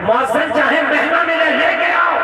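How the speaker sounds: ringing, insistent command; emphatic exhortation. Real, not acted. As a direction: urge the crowd, climbing to a shout. Urges the crowd forcefully.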